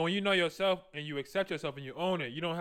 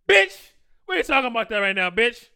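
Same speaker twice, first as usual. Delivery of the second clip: loud, higher comeback to a critic; acted sharp retort; 4 semitones higher, 9 dB louder than usual. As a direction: cut the scolder off loudly. Acted retort to disapproving outsiders.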